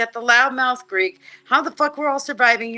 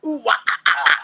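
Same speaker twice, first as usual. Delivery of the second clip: mock rock wail sounds; the nu-metal wah yell imitated; 4 semitones higher, 4 dB louder, faster than usual. Shown as a demonstration. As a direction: belt out the metal scream riff. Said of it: "I can do that".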